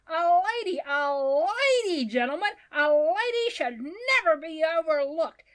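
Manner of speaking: harangue the crowd toward a noble deed; impassioned orator. A narrator's reading. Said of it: "assumed the position of an orator".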